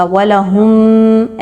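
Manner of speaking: slow, drawn-out recitation; slow tajweed recitation; Quranic recitation. Shown as a demonstration. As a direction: chant the word slowly as in tilawat. Teacher recites Quran word.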